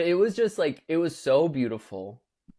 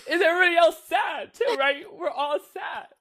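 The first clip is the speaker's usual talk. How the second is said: mock crying, pitched way up; mock tearful wail. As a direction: ham up a weepy shout to friends. Forcing yourself to cry at death scene.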